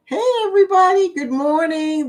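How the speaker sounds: high excited hello to the whole chat; excited greeting. Real, not acted. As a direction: burst in with a big cheery hello. Opening greeting to viewers.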